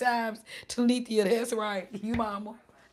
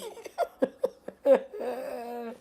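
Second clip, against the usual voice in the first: laughing; 6 semitones higher than usual. Real, not acted. Laughing at nose blowing.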